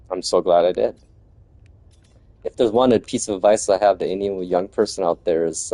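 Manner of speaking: normal talking voice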